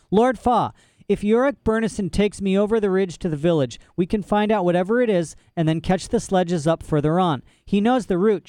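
put-on high, eager girl's voice; plead, urging hard; urgent girl. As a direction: Lyra urging Lord Fa.

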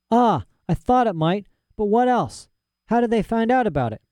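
put-on high, eager young girl; high-voiced girl talking to her father; girl's voice Lyra. A narrator's reading. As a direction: rattle it off like a bold child. Lyra curious.

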